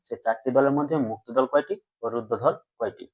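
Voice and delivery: ordinary delivery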